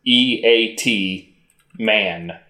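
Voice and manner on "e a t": E A T spelled slowly letter by letter. Shown as a demonstration. Co-host spells the title for host, slow letter by letter.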